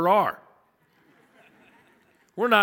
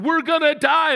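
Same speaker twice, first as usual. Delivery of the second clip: higher, louder panicked cry; panicked adults; 10 semitones higher, 8 dB louder than usual. Retold or imitated. Adults on the dropping plane crying out in panic. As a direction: cry out in mock terror. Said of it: "they hear adults going".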